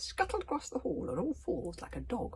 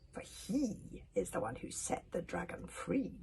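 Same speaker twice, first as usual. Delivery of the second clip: plain reading voice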